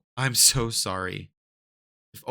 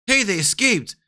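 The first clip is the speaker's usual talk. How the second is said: a different guard, high loud shout; alarmed shout, other guard; 11 semitones higher, 7 dB louder than usual. Acted. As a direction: another guard yelling the alarm. Second guard calls alarm.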